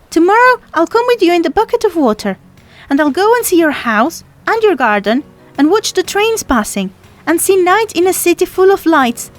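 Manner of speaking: put-on high, hopeful young girl voice; girl from the sea, eager. A narrator's reading. Girl from the sea tells the boy her plans, eager and high.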